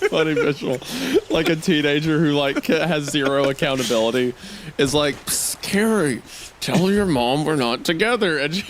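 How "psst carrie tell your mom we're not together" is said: put-on teen boy voice. Teen boy whispering to Carrie.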